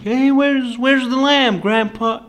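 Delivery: high, loud, eager grandchild asking. Retold or imitated. Grandson asking his granddad about the missing lamb, high eager call.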